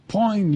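high "poing" with a falling tail; imitated boing. Showing how literally it struck me at Mass, high boing. Repeats the sound effect of realization.

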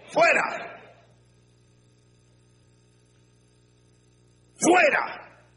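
high, ringing command; preacher ordering doubt out, high and commanding; commanding cast-out. Acted. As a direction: banish it from the room. Casting out doubt.

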